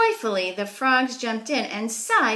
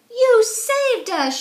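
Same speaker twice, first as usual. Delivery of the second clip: slow, loud, high joyful shout; 9 dB louder, slower than usual. A narrator's reading. Frogs shouting thanks to their rescuer, loud and high. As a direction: call it out big, thrilled and grateful. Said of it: "shouted the frogs".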